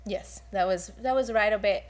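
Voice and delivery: usual speaking voice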